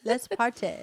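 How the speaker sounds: English accent gone wrong, light and playful; attempted English accent. Shown as a demonstration. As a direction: try an English accent, miss it. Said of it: "That was not English accent".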